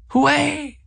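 high-pitched imitated cheer; mock festive cry, very high; dancers cheering. A narrator's reading. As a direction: whoop it high like distant revellers. Dancers' cheer repeated in background.

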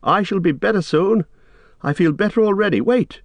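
high-pitched, firm calling; Frodo calling back strongly. A narrator's reading. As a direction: call up to hold someone back. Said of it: "Frodo called back more strongly now".

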